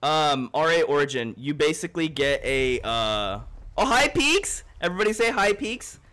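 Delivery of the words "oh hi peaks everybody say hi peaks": excited greeting. Real, not acted. Greeting a viewer.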